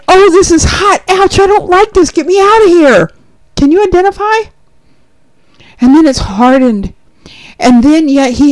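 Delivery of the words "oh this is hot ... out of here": high, louder pained yelp of the clay; clay in the furnace. Acted. Preacher voicing clay in the furnace, high pained protest. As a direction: cry out like clay burning in the kiln, pitched high.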